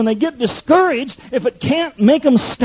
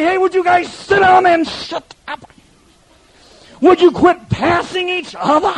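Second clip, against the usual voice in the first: exasperated shout; 6 semitones higher, slower than usual. Retold or imitated. Pastor yelling at a couple who keep missing each other, exasperated shout. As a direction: bark it out like a fed-up pastor. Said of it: "And you say".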